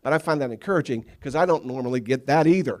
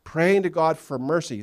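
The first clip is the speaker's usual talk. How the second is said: slow pleading; 4 semitones higher, 3 dB louder, slower than usual. Retold or imitated. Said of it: "he asks".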